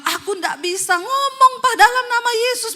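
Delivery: high, loud, dragged-out protest; struggling possessed husband. Retold or imitated. Possessed husband cannot say the name, high strained cry. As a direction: strain loudly to get the words out.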